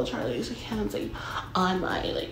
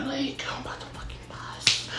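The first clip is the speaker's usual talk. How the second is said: whispered aside; 6 semitones higher, 7 dB quieter than usual. Real, not acted. Storyteller confides to listeners, whispered. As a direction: whisper it, unvoiced and secret.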